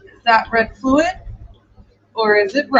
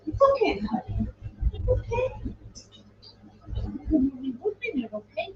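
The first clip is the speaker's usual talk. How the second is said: soothing the dog; 4 semitones higher, 11 dB quieter than usual. Real, not acted. Owner to her crying dog Roxy, high soft cooing. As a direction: coo softly to calm a whining puppy.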